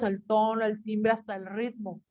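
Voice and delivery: ordinary talk, nothing marked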